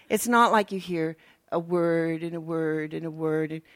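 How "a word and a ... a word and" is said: slow, droning monotone; mock-solemn, stretched-out divine voice; mock voice of God. Shown as a demonstration. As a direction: drone it out word by word, drawn out. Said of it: "like that".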